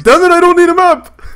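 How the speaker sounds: loud, high, hammed-up exasperation; stubborn elderly driver snapping back.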